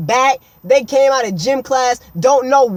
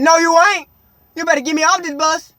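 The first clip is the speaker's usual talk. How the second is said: loud, high protest; defiant demand; 7 semitones higher, 6 dB louder, faster than usual. Retold or imitated. Kid arguing with bus driver to get off, defiant. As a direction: push back hard, voice raised.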